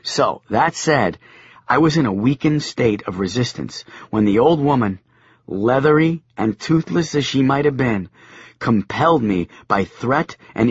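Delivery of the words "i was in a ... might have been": ordinary delivery, nothing marked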